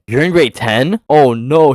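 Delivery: freaking out. Retold or imitated. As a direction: play her alarmed realization. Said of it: "freaking out".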